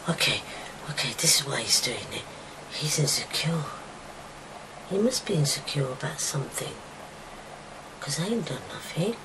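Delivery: hushed private realisation; whispered inner thought. Retold or imitated. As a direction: think it through under your breath.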